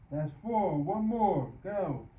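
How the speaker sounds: loud, punchy push; calling out reps. Real, not acted. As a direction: urge on to the last rep.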